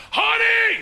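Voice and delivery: shrieked high across the store; piercing public holler; loud shout. Acted. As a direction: yell it to embarrass your wife. Yelling again in store.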